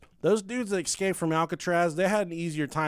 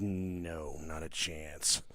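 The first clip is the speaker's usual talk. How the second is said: stern mom; 11 semitones lower, 9 dB quieter, much slower than usual. Retold or imitated. Mom refuses, low and slow.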